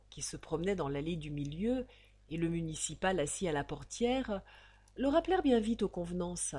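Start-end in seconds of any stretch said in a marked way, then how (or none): none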